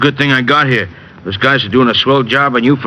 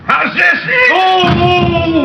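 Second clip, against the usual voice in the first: hammed-up cry; much slower than usual. Wrestler hamming up pain.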